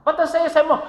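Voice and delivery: bright rhetorical teasing; playful question. Acted. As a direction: tease them with the question. Same question again, raised.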